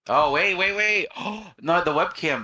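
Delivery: high, loud "wait, wait"; sudden alarm. Real, not acted. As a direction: catch a problem mid-sentence. Suddenly notices the webcam problem.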